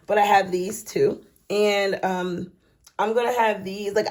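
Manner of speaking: ordinary talk, nothing marked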